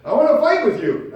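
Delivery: high, loud, picking a fight; loud mock challenge; angry shout. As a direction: shout it like you're itching for a fight. Acting out a quarrel.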